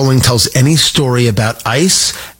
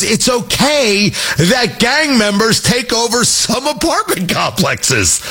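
slow, higher-pitched mock argument; mocking paraphrase; 7 semitones higher, slower than usual. Host mocking interviewer's argument about gang takeovers, drawn-out mockery. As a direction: draw it out high in mock disbelief.

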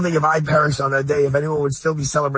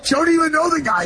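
much higher, amused disbelief; disbelieving aside; 12 semitones higher than usual. Retold or imitated. Host retells the widow's baffled aside, amused. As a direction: mimic her baffled aside. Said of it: "you looked at me and goes".

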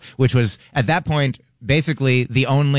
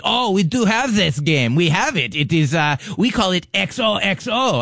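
mock French accent; 7 semitones higher than usual. French guys explain their name for the game, hammed-up French accent. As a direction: ham up the French guy proudly naming the game. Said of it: "a perfect accent".

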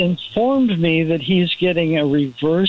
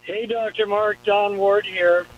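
faint, off-the-phone greeting; muffled speakerphone hello; distant on speakerphone; 3 semitones higher, slower than usual. Real, not acted. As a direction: call in from too far off the mouthpiece. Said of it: "Talk louder into the".

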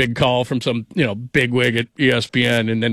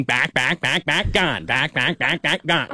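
a hint of Chris Berman's home run call; echoing home run call, lightly imitated; slower than usual. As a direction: lightly mimic the back, back, gone call. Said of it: "Chris Berman used to do that thing".

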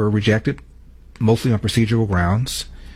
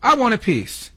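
imitating someone yelling from a crowd; imitated yell; 11 semitones higher than usual. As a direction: mimic an excited heckler crying out, pitched way up. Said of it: "You hear that person yell".

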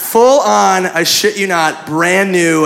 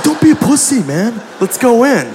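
pushy, higher-pitched buddy voice; goading friend; 5 semitones higher than usual. Retold or imitated. Buddy urging nervous friend into the building, goading. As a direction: egg him on, pitched up.

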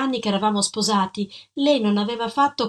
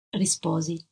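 plain reading voice

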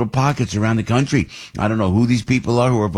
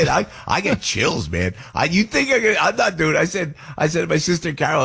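ordinary talk

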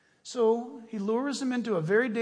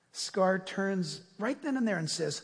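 ordinary delivery

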